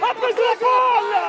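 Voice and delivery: high, loud yell of protest; protesting shout. Real, not acted. Player disputes a call during the match, shouting in protest. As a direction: shout the objection at full force.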